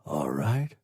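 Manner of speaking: ordinary delivery, nothing marked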